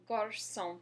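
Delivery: Garçon, ç as s, no final n; French garçon. Shown as a demonstration. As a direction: repeat Garçon for the learner. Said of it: "Go ahead and say garçon".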